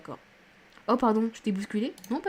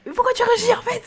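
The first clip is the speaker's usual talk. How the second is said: cri suraigu et indigné; exaspération criée; 8 dB louder than usual. Real, not acted. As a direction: protester très fort, voix qui grimpe. S'exaspère contre le jeu. (crier d'exaspération, très aigu)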